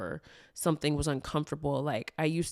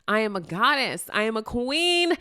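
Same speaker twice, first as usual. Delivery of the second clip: raised, high-pitched proud declaration; emphatic affirmations; 5 semitones higher, 4 dB louder than usual. Shown as a demonstration. Speaker hyping themself with affirmations, proudly emphatic. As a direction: build up and proclaim it grandly. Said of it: "saying my affirmations".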